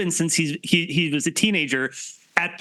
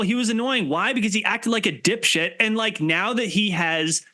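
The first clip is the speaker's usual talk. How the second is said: usual speaking voice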